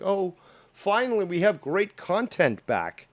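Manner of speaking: mock cheer.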